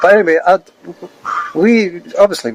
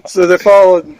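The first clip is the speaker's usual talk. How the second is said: laughing; 5 semitones higher, 3 dB louder than usual. Retold or imitated. Retelling boss firing him, laughing.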